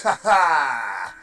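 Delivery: evil laugh. Acted. Mock villain laugh after the Steam bit.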